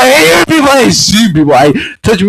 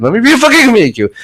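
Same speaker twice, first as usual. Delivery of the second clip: ordinary talk, nothing marked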